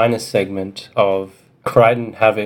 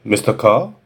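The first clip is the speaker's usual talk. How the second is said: put-on French accent. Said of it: "The voice had a heavy French accent".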